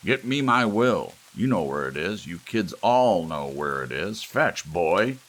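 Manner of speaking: loud, high bossy grandfather; imperious old patriarch barking orders; tyrannical old man. A narrator's reading. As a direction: order him about like a dog. Gramps ordering Lou about.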